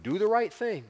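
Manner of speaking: high, earnest appeal; pleading. Acted. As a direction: urge it like a plea for repentance. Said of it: "God is pleading with Cain".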